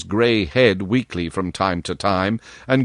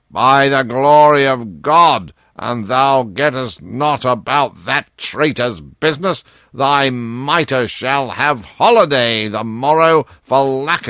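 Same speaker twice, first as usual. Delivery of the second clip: wrathful king; 3 semitones higher, slower than usual. Acted. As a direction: explode at the kneeling Chancellor. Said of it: "his face flushed with wrath".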